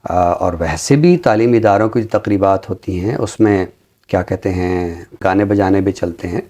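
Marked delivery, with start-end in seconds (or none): none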